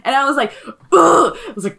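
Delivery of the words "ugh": loud, high exasperated "ugh"; exasperated grunt, pitched up and loud; frustrated groan. Retold or imitated. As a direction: let out a fed-up groan. Retold frustration with rude customer.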